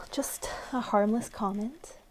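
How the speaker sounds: whisper. Acted. Innocent whispered reply.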